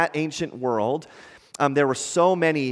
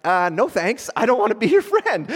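mock refusal; 6 semitones higher than usual. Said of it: "You'd be like".

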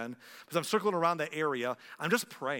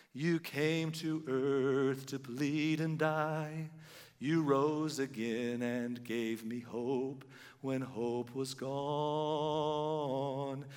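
very slow, measured recited verse; poem recitation; much slower than usual. Acted. Pastor closing the sermon reciting a love poem, very slow. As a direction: recite it like a hymn line by line.

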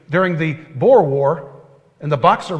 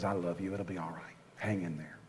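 much softer, lower, gentle comfort; tender reassurance; 10 semitones lower, 21 dB quieter than usual. Retold or imitated. Preacher voicing God comforting his frustrated child, tender and hushed. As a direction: soothe like a loving father. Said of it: "God just looks down and says".

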